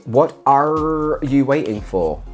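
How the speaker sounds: lightly voiced pirate with a slow, stretched-out "are"; hint of pirate. Reading a pirate bath bomb's instructions aloud, lightly piratical. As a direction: draw it out a little like a pirate.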